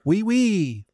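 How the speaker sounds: put-on oui oui, very high and sing-song in a French accent. Mocking the French Sherlock's smug confession, in a high, comic French accent. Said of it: "That's my French accent".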